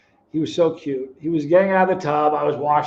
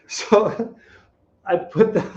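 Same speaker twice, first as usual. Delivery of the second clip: cracking up mid-story; real laugh breaking up the words; 5 semitones higher, much slower than usual. Real, not acted. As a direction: try to talk through the laughter. Cracks up at own lipstick story.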